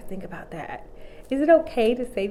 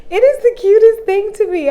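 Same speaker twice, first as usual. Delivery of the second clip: gushing laugh; 11 semitones higher, 10 dB louder than usual. Real, not acted. Gushing over sassy old people.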